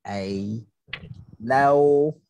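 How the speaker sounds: ordinary talk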